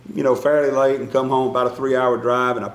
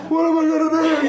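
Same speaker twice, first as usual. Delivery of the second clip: much higher, panicky cry of helplessness; panicked wail; much faster than usual. Retold or imitated. Husband on the phone to wife Sherry, higher panicked wail. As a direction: squeal it like you're at your wits' end. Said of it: "And then I call Sherry and say".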